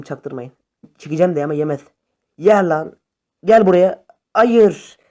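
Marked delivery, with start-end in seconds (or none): 0.0-0.5 soft conspiratorial hush; quiet "don't let on"; hushed aside. Real, not acted. 2.4-5.0 loud gamer outburst; heated yelling. Real, not acted. Heated fight, then dying.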